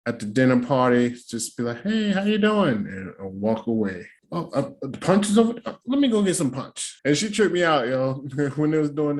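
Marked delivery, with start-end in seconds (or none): 1.8-2.9 high, polite mock hello; breezy party greeting. Acting brief polite party greeting. 4.3-7.0 high, stammering getaway line; mock-polite escape, pitched up; pretend polite excuse. Acting an excuse to escape her.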